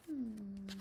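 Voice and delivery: ordinary delivery, nothing marked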